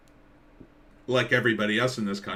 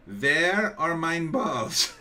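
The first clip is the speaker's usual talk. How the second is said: higher, slow, dragged mock German plea; mock German dog; 7 semitones higher, much slower than usual. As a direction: whine it like a sad German dog. Comment voicing neutered German dog.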